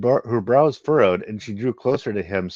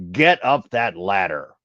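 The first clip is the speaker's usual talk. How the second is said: slow, louder gruff voice; gruff order; 4 dB louder, slower than usual. Acted. Harak orders Genesis up the ladder, slow and gruff. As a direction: command her firmly.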